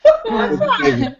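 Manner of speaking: high-pitched, breaking up with laughter; laughing. Real, not acted. Host delighted by a contestant's failed accent, laughing hard. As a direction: praise it through helpless laughter.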